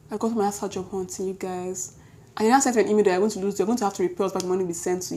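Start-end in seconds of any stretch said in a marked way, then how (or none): none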